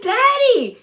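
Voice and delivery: put-on high, loud teenage-style protest; retold protesting cry. Embarrassed daughter calls out to father, retold protest. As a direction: cry out as if to dad.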